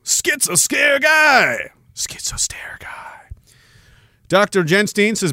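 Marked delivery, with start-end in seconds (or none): 0.0-1.7 slow, high shouted name, mocking. Reading a username, mock shout. 1.9-3.1 slow unvoiced whisper of the name. Correcting the username in a whisper.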